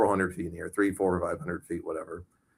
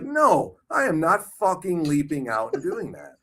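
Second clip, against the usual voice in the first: retold refusal; 9 semitones higher than usual. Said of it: "I'm like".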